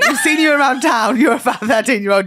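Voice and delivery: high, loud mocking quote; mocking fire brigade voice. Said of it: "They were like".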